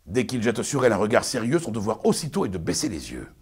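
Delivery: pompous Arnolphe, lecturing his bride; booming, sermonizing stage declamation; hammy stage actor. Acted. Performs Arnolphe's tirade.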